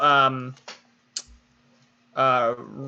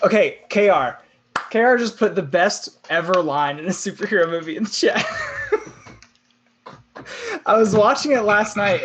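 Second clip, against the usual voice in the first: loud and high, laughing into it; excited; 7 semitones higher, 4 dB louder than usual. Real, not acted. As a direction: burst out with delight. Reacting to a chat line.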